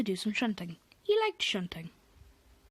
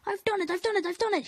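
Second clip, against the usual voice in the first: higher and louder triumphant; proud; 9 semitones higher, 4 dB louder than usual. Acted. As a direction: crow it from the hilltop. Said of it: "he said proudly".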